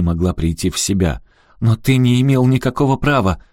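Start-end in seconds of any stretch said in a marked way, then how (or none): 1.6-3.4 high, loud protest; indignant and forceful; outraged. A narrator's reading. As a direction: accuse with a raised voice. Outraged daughter accuses her father.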